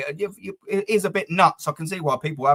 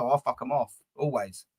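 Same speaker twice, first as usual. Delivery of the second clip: ordinary delivery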